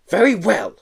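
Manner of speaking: irritable snort. Acted. Teacher irritated by the boy's request. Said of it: "snorted irritably".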